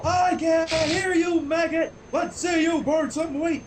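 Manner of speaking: slow, high barked taunt; drill sergeant shout. Acted. Dash yelling through a bullhorn at Pinkie, drill sergeant shout.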